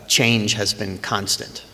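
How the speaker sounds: normal talking voice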